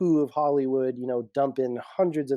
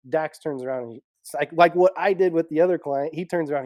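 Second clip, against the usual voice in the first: ordinary talk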